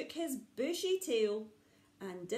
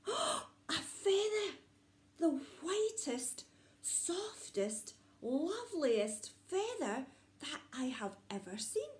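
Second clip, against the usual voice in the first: awed delight; 6 semitones higher than usual. Acted. Little owl finding a feather, high awe fading to whisper. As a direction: marvel at something beautiful.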